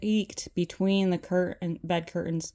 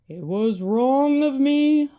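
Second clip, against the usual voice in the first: ghostly voice; 4 semitones higher, 7 dB louder than usual. Acted. Ghost Marley.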